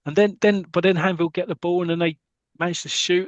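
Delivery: ordinary delivery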